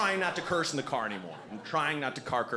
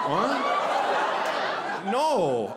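shocked; 6 semitones higher, 4 dB louder than usual. Real, not acted. Shocked at misunderstanding.

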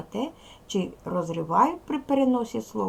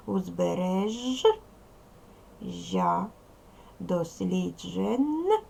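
Узбережжя, дослідження drawn out slowly, split at the hyphen; slow syllable split; slower than usual. Shown as a demonstration. Teacher shows children the other hyphenation, slowly. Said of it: "що можна і отак".

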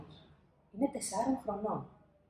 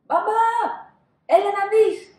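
very high, loud alarmed call; 12 semitones higher, 14 dB louder than usual. Acted. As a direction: shout to dad in fright. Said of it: "φωνάζει ο Άλφονς". (πολύ ψηλή τρομαγμένη φωνή)

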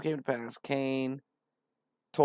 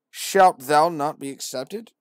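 slow, louder preacher line; solemn verse; 4 semitones higher, 12 dB louder, slower than usual. Acted. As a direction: proclaim the question. Verse read out.

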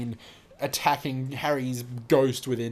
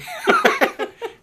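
a sharp high squeal of laughter; sudden high laugh; 10 dB louder than usual. Real, not acted. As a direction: burst out laughing. Laughs mid sentence.

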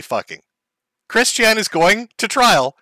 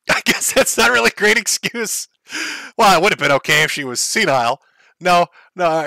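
ordinary talk, nothing marked

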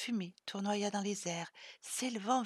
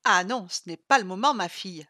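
loud, teasing put-down; mock-scolding, bursting in loudly; 9 dB louder than usual. A narrator's reading. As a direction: brush off the drama with a loud scoff. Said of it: "se moqua Sylvie".

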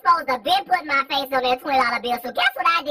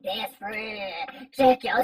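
lower mock Fat Peaches; 7 semitones lower, 4 dB quieter, much slower than usual. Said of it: "it was more like".

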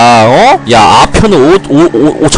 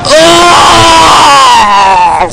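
a long, high startled yell, much higher than usual; 12 semitones higher, much slower than usual. Real, not acted. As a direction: cry out long as if you are falling. Jumping or falling off bike.

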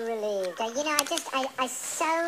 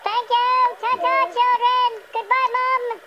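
high, loud sing-song farewell; cheery goodbye; 11 semitones higher, 7 dB louder than usual. Acted. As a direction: wave them off brightly.

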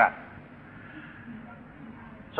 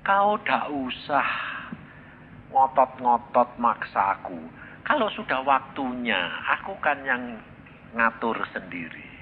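calm voice of God; 6 semitones lower, 6 dB quieter than usual. Retold or imitated. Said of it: "Tuhan itu malah jawab gini".

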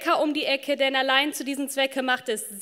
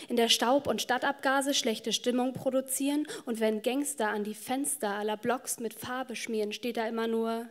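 usual speaking voice

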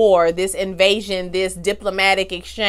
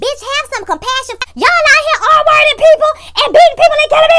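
shrieked, much higher and louder; screaming at the TV; 10 dB louder than usual. Retold or imitated. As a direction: scream it at the screen in outrage. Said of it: "you wanna scream at the T V".

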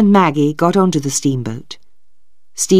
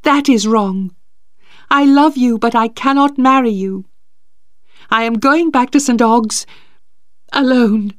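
put-on higher young woman, insistent and pained; Maggie's voice; 8 semitones higher than usual. Maggie refusing to marry Stephen, high and insistent. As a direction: lift the voice high as she refuses him.

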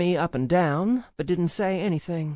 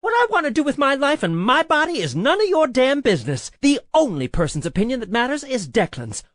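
loud, raised-pitch tirade; heated, forceful telling-off; angry yelling; 5 semitones higher, 7 dB louder than usual. A narrator's reading. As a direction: let it all out in a furious rant. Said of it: "standing up angrily".

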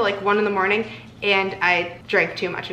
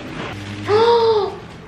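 high laughing gasp; delighted gasp; 12 semitones higher than usual. Real, not acted. Gasp at gift.

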